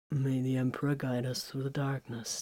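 low, soft mumble to himself; mumbled. Acted. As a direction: mutter it under the breath like a quiet prayer. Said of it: "Titus mumbled to himself".

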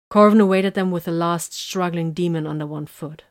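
ordinary delivery, nothing marked

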